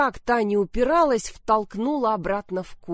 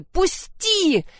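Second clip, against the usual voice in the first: sharp cry; 11 semitones higher, 5 dB louder than usual. Acted. Milena breaking free.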